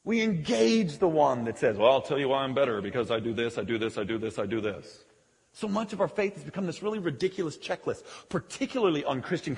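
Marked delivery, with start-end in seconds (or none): 1.8-5.1 low, quiet, droning list; self-righteous recital. Acted. Religious bigot listing why he is better, droning. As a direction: drop low and reel it off mechanically.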